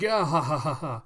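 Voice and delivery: loud staged cackle, easing off; mocking guffaw. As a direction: keep laughing at the poor man. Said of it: "then just continue laughing".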